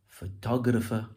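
"photographer" with TOG stressed; stress on second syllable. Shown as a demonstration. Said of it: "Emphasis shifts".

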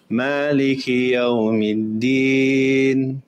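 Yawmiddin held longer, about four counts; longer stretch. Shown as a demonstration. Teacher showing a longer madd length. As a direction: draw the final vowel out longer. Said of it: "all the way to six".

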